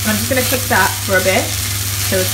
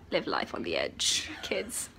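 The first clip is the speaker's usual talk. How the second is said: whispering; 5 semitones lower, 17 dB quieter, slower than usual. Pretend. Jokey sign-off to viewers.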